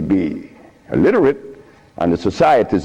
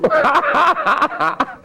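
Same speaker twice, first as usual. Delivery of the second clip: laugh; 11 semitones higher than usual. Real, not acted. Laughing at own mischief.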